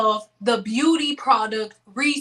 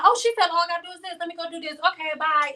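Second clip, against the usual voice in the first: higher, very fast airheaded chatter; mock gullible viewer; 6 semitones higher, much faster than usual. Vlogger imitating a viewer who obeys blindly, fast and high. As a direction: rattle it off like a mindless follower. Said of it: "You just like".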